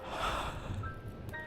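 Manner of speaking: long breathy sigh of relish; soft, indulgent exhale; savouring sigh. Acted. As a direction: breathe out slowly, savouring a scent. Savouring the smell of blood.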